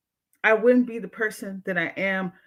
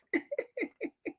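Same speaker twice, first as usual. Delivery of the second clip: high laugh; 13 semitones higher, 11 dB quieter than usual. Real, not acted. Laughing at a viewer comment.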